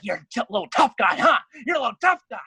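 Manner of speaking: fast, loud mocking jeer; mocking cop. Cops jeering at him in the jail, fast loud mock. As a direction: sneer it in his face.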